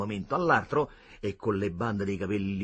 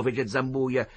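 usual speaking voice

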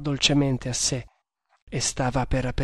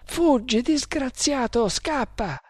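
very high, desperate pleading; panicked urging; 11 semitones higher than usual. A narrator's reading. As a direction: drive him away in a high-pitched panic. Terrified girl urges him to flee. (esortazione disperata, voce acutissima)

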